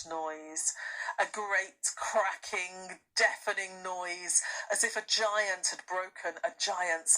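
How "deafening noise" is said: usual speaking voice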